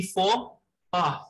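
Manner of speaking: "faux pas" said "foh-pah", X and S silent; silent-letter pronunciation. Shown as a demonstration. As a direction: demonstrate "faux pas" as two syllables, "foh" then "pah". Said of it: "The pronunciation will be".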